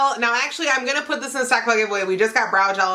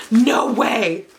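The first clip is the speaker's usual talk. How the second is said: normal talking voice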